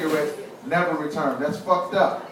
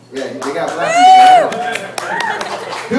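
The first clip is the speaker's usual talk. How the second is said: hillbilly voice; 8 dB louder, much slower than usual. Retold or imitated. Mocking hillbilly reaction line.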